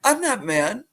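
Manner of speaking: lightly voiced kind father; reassuring dad. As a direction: soothe her, holding back a laugh. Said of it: "He goes".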